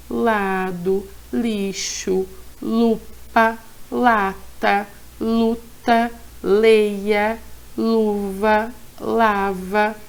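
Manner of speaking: each word read out on its own, clearly; slow deliberate reading of a word list; careful reading aloud. Shown as a demonstration. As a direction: model a neat word-by-word reading for the kids. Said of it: "Faz essa leitura bem bonita".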